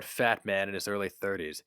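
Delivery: usual speaking voice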